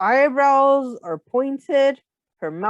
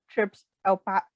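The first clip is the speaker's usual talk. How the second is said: ordinary delivery, nothing marked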